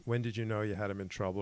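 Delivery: ordinary talk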